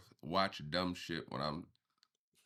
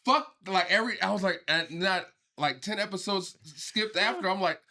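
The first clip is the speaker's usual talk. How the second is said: worked up; 9 semitones higher, 6 dB louder than usual. Retold or imitated. Feverish irritation retold loudly.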